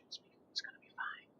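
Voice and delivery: soft hushed reassurance; soothing whisper. Pretend. Streamer whispering reassurance over her city, hushed. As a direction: whisper it calm, like soothing a child.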